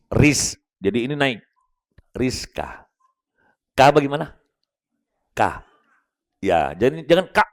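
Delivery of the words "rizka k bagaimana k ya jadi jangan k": normal talking voice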